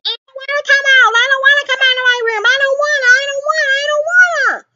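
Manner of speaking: put-on high, fast whining teen voice; mock sulky teenager whining high and quick; whiny teenage Groot. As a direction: whine it like a sulky kid locked in his room. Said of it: "Like teenage Groot saying".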